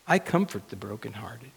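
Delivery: soft, gentle reply; God's voice. Acted. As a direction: answer quietly, as God comforting the hurting. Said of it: "And God's voice says".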